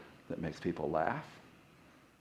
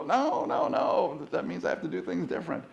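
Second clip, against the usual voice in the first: high, mock-panicked protest; mock resistant brain; 6 semitones higher than usual. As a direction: play the part of you that resists, lightly. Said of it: "parts of your brain that are gonna light up and go".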